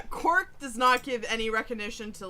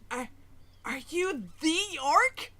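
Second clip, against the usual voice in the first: higher and louder, building to a squeal; starstruck fan; 8 semitones higher, 5 dB louder than usual. Acted. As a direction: gush at the hero like a giddy fan. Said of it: "and he goes".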